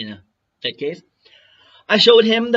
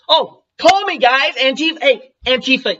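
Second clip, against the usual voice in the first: taunting call; 6 semitones higher, 5 dB louder than usual. Real, not acted. Taunting opponents to call him.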